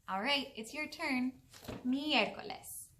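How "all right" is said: ordinary delivery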